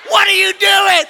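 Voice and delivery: high, loud startled yell; outraged shout. Retold or imitated. Preacher plays dad hit by toddler, outraged shout. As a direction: yell it like a dad just whacked.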